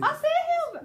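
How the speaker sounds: high, loud rushed burst; really fast. Shown as a demonstration. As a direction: rattle it off in character. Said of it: "You've got to be really fast".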